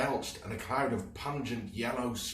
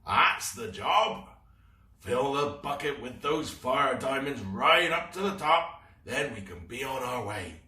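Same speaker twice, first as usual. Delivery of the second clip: pirate captain; 6 semitones higher, 7 dB louder than usual. Acted. Captain Claw gloating orders.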